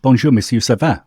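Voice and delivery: French accent. Shown as a demonstration. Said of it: "So French".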